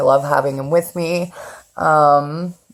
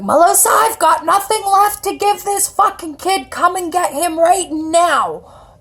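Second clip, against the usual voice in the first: put-on high, exasperated old lady voice, dragging the end; voicing grandma, fed up and done; grandma voiced; 12 semitones higher than usual. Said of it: "So she's like".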